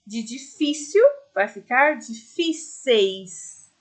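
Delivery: difíceis said slowly, stress on fí, clear eis ending; slow model plural. Shown as a demonstration. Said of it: "Tira a letrinha L e acrescenta eis".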